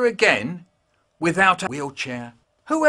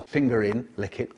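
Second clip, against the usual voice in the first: quiet; 5 semitones lower, 8 dB quieter than usual. Acted. Creepy quiet aside.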